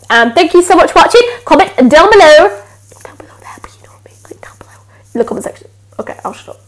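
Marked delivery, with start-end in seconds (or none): 3.0-6.6 hushed whispered aside; whispering. Real, not acted. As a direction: drop to a whisper, sheepish.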